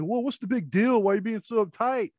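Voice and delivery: dismissive. Retold or imitated. Guy's dismissive quote.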